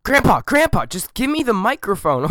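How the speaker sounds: high, urgent plea; pry the mic away, half laughing; host begging grandpa for the microphone; exasperated. Acted.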